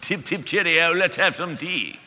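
lightly put-on posh British accent, slow, with a laugh; a hammed-up British tea-party voice; mock British accent. Preacher joking about English-speaking Jesus, mock British accent. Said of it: "You hear?".

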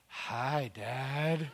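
low, soft, slow creepy greeting; creepy toddler. Retold or imitated. Staring toddler greets dad at night, creepily soft. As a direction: imitate an eerie little kid, hushed and low.

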